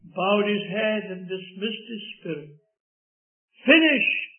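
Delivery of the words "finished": "Finished" cried out high and loud; Saviour's cry. Retold or imitated. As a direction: ring out "Finished" as a triumphant cry. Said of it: "cried the Saviour".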